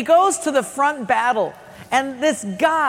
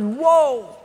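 drawn exclamation of a struck giant; mock stunned surprise; 3 semitones higher than usual. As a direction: react as if suddenly hit.

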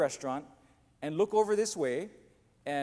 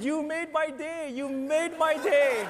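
laughing delight; 11 semitones higher, 6 dB louder, slower than usual. Retold or imitated. Wayne laughing hard.

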